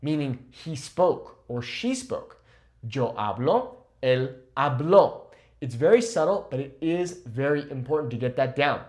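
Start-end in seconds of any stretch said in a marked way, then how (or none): 2.9-5.2 "hablo" then "habló" with the final O stressed; slow, pushing the end of "habló"; stress contrast. Shown as a demonstration. Teacher contrasting "Yo hablo" with "él habló". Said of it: "emphasizing the O".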